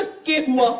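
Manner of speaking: mock-tough fake Haryanvi; puffed-up Haryanvi. Repeating his bluff to the stranger, puffed-up Haryanvi. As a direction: put on a bold Haryanvi accent. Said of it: "एकदम चौड़े होके बोला".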